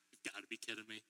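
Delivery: low, quiet mutter of disbelief. Retold or imitated. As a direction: mutter it low, half to yourself. Reacting to the broken furnace.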